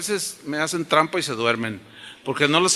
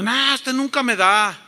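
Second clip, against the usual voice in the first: raised-pitch mock protest; child's complaint; 6 semitones higher than usual. Boy protesting to his dad who gives nothing, high complaint. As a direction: whine at dad in a higher voice.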